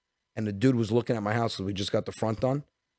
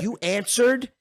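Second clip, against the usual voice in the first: loud, high mock outrage; incredulous scolding; 8 semitones higher, 5 dB louder than usual. Wife scolding husband for answering in costume, high and incredulous. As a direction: imitate an appalled wife, voice shooting up.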